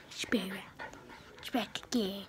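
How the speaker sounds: low, soft whispery try at a voice; failed voice attempt. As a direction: mumble it like a botched character voice. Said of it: "I can't do a voice".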